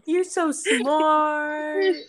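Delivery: put-on high, slow baby-talk pet voice; dog voice. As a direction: coo it like talking to a puppy. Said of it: "My dog voice".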